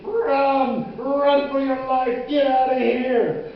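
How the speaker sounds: high, drawn-out shouted warning; ear-splitting yell. Acted. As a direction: bellow an alarm like a megaphone. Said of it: "The megaphone on my shoulder was ear-splitting".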